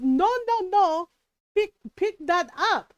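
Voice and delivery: mom scolding. Retold or imitated. Mom to son who spat out chips, scolding. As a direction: imitate a mother urgently telling off her child.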